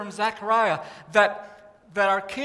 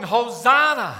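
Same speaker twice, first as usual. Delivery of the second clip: called out louder, like the crowd; crowd shout; 8 dB louder, slower than usual. Retold or imitated. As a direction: cry it out to welcome the king. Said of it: "they start yelling".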